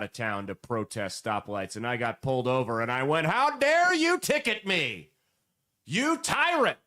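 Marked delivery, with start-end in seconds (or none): 3.3-6.8 high, slow hammed-up indignation; mock outrage. Speeder scolding the cop who pulled him over, mock outrage. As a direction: play the offended speeder, overdone.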